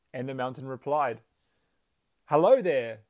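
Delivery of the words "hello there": very loud echoing greeting; pleasant echoed call. A narrator's reading. Mountain answering the father, pleasant shout. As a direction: return the friendly call as an echo. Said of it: "this time the voice didn't seem so grumpy".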